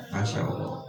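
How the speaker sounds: soft whispered awe; whispered Masya Allah. Real, not acted. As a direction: breathe it out as a hushed praise. Pious exclamation mid explanation. (berbisik penuh takjub)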